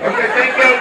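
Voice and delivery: loud, eager mock compliance; obedient husband. Husband obeying the wife who finds his socks, loud compliant voice. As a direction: answer loudly like a dependent husband. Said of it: "This is me. You're like".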